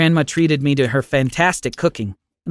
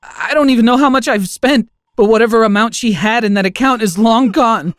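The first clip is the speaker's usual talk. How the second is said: higher, strained tearful voice; sobbing; 8 semitones higher, 5 dB louder than usual. Acted. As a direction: confess it half-sobbing, voice pushed up. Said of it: "I sobbed".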